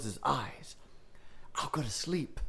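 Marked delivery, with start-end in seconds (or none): 1.5-2.4 hushed whisper. A narrator's reading. Whispered hiding under blankets.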